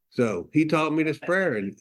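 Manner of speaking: ordinary delivery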